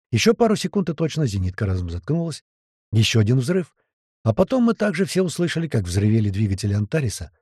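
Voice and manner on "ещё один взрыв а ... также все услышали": usual speaking voice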